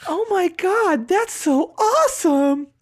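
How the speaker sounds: high, slow, gushing squeal. Retold or imitated. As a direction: gush over it like a starstruck fan. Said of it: "she goes".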